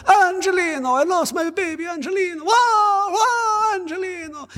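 very high, hammed-up crying wail; Italian widow sobbing, fake and big; mock Italian wailing. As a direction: wail like an overplayed movie mother. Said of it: "you always see it in the movie".

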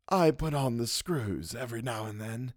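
soft, knowing boast; a lightly voiced schemer; sly old man voice. Wilde boasts softly.

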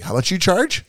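mock-eager and punchy; eager retold reply. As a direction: act out snapping up a bargain. Retelling haggling over a hire.

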